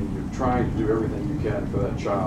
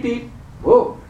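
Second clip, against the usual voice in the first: mock car horn; 11 semitones higher than usual. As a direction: do the horn and the jolt awake. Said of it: "a car came up ... went beep".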